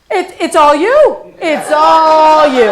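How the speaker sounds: put-on a scared kid caving in; intimidated teen. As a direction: back off nervously, all yours. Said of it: "He says".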